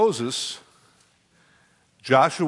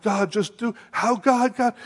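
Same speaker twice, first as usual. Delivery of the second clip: mock crying out; 9 semitones higher than usual. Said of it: "they're crying out to God".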